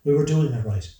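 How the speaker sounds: loud, high-pitched objection; heated protest. A narrator's reading. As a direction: protest it loudly, worked up. Acted quarrel over the plan.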